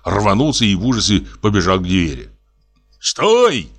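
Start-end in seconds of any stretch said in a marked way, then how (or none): 3.0-3.7 high-pitched command to halt; sharp cry. A narrator's reading. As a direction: cry out to stop a runaway. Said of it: "крикнул дядя".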